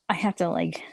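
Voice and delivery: barely voiced whisper, holding back a laugh. Real, not acted. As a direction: whisper it to yourself. Whispered lead-in before laughing.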